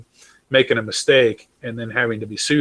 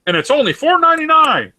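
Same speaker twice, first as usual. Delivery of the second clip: much higher, louder cheery pitchman tag line; mock ad price; 14 semitones higher, 5 dB louder than usual. Mocking the ad's cheery price tag, high and loud. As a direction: chirp the price like a cheesy commercial. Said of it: "you watch an ad".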